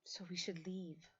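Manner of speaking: a breathy unvoiced whisper. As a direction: barely voice it, just air. Lilith mutters a quiet suggestion.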